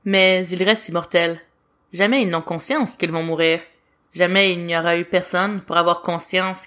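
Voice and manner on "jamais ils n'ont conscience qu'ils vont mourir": usual speaking voice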